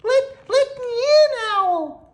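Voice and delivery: very high, loud, hurried little mouse; squeaky Mouse calling. A narrator's reading. Mouse calls at Owl's door, squeaky high call. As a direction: squeal it like a tiny desperate mouse. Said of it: "called Mouse".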